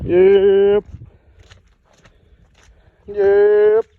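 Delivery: high, loud call across the field; calling out to livestock. Real, not acted. Farmer calls the animals in from afar, loud high yep. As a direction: holler a short yep to the herd.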